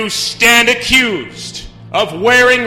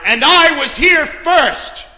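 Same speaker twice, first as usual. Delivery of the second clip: higher, forceful outburst; insistent, ringing claim; indignant shout; 11 dB louder, slower than usual. Acted. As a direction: shout down the upstart. Asserts seniority over accused.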